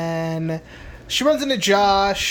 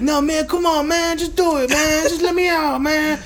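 high pleading voice, pestering his friend; whiny begging teen; 9 semitones higher than usual. Retold or imitated. Son begging the guard to let him out, high and whiny. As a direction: beg like a bratty kid. John begging Ronnie at gate.

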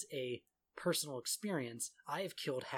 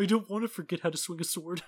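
higher, louder whiny plea; mock pleading; 5 semitones higher, 8 dB louder than usual. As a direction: whine it like a scolded squire. Pleading with abusive master.

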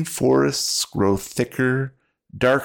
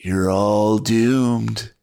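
slow ominous drawl; much slower than usual. Acted. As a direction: drag out the doom like a threat. Driver teases ominously.

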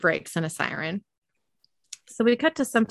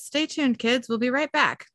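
TV announcer; 6 semitones higher than usual. Acted. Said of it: "a voice on the T V says".

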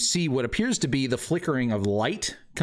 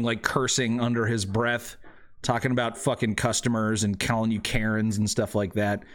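ordinary delivery

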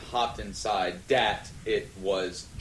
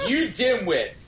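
scornful insult; 9 semitones higher, 4 dB louder than usual. A narrator's reading. Insult with high pitch.

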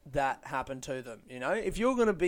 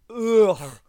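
a much louder ugh of pure disgust; loud disgusted groan; 6 semitones higher, 12 dB louder than usual. Real, not acted.

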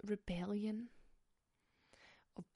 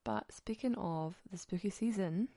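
ordinary talk

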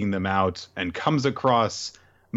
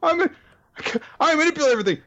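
very high, loud mock outrage; exasperated villain; 3 dB louder, faster than usual. Villain cursing the snowstorm, very high and loud. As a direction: shriek at the snow like a foiled villain. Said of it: "Muskar's like".

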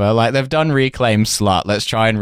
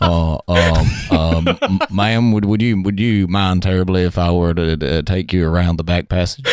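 lower, hesitant posh-suitor voice; bashful English gent; 3 semitones lower than usual. Pretend. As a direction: play the shy stammering suitor.

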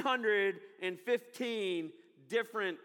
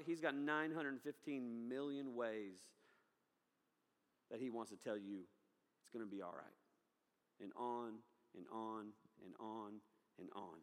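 reassurance fading to a hush, much softer; 9 semitones lower, 11 dB quieter than usual. Real, not acted. Preacher to congregation, reassuring them, fading to a hush. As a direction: let it die away gently, much softer.

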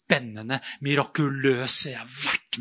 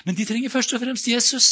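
ordinary delivery

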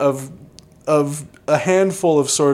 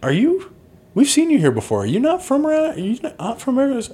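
high questioning; 13 semitones higher than usual. Retold or imitated. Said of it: "Cindy goes like".

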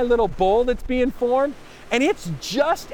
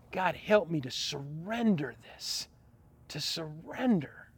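low, soft repeated surrender; hushed prayer; 5 semitones lower, 11 dB quieter than usual. Real, not acted. Pastor praying to God, soft low surrender. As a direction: breathe it out like a plea.

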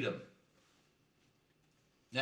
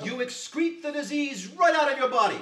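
triumphant pitch; 9 semitones higher, 11 dB louder than usual. Acted. Salesman caps the pitch.

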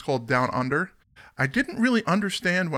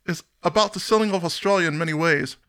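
Australian accent attempt; 6 semitones higher, 4 dB louder than usual. Host reading the band's quote in an Aussie accent. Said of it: "I get into the [name] speak when I start going Australian".

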